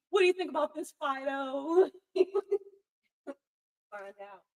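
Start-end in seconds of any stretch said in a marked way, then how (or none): 0.1-1.9 loud, higher, teasing pet-owner voice; loud mocking pet talk. Owner mocking people who quiz their pets, loud and laughing. 1.9-2.7 loud laugh. Real, not acted. Laughing at own joke.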